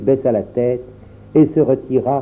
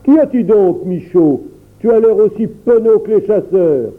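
ton railleur; 8 semitones higher, 4 dB louder than usual. A narrator's reading. Le père interroge le garde.

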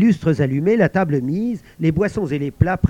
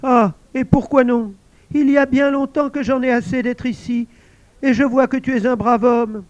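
high, pleased voice; a lonely spirit delighted at last; invisible servant exclaiming; 8 semitones higher, 4 dB louder than usual. Acted. Servant thanking the guard, high excited voice.

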